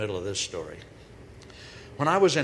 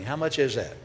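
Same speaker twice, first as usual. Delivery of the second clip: normal talking voice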